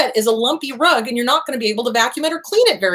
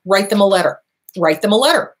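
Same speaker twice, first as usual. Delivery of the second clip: ordinary talk, nothing marked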